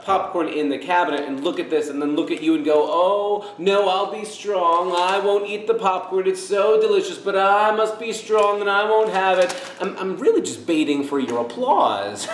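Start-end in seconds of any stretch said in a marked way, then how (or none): 2.9-9.5 higher, put-on noble suffering; hammed-up self-denial in a raised voice; mock martyr. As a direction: play up your brave sacrifice for an audience. Acted show of fasting for applause.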